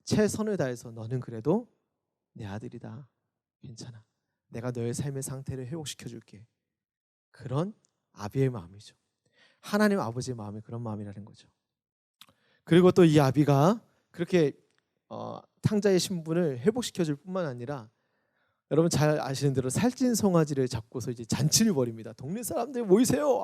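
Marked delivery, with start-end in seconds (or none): 0.9-6.5 much softer, low, gentle comfort; tender reassurance. Pretend. A father comforts his returning son, soft and tender. 22.1-23.4 high, cheerful call across the village. Pretend. A father calls the neighbours to the feast, loud and high.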